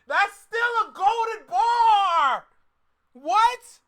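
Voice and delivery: high, drawn-out yell of hype; amazed shout. Real, not acted. Reacting to a favourite rap lyric, real amazed shouting. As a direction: holler it, blown away by the line.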